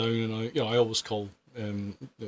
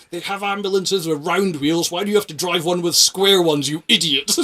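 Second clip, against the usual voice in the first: higher, louder hectoring shout; mock shout; 7 semitones higher, 11 dB louder than usual. Old patient shouting at the driver through the hatch, loud. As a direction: bellow insults through the hatch. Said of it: "this guy just shouting".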